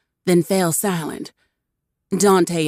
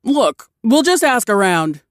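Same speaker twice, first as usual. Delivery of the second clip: higher, louder call; orc calling out; 5 semitones higher, 4 dB louder than usual. A narrator's reading. Nameless orc calls out to the captain, higher and louder. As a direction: call out to the others. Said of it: "called the nameless orc".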